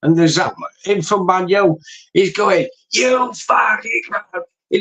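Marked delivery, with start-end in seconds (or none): 2.9-4.0 high, drawn-out mock fury; imitated rant. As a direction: imitate a raging football fan without real volume. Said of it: "These ten-minute clips of his rants".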